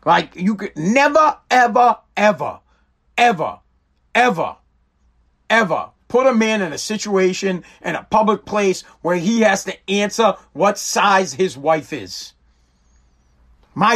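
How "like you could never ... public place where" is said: each ever hammered out on its own; stressed repeated ever. Real, not acted. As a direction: drag out ever, ever, ever.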